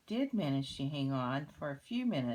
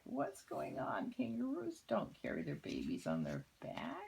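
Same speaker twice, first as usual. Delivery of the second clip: hushed little turtle voice, higher; whispered turtle voice; 5 semitones higher than usual. Pretend.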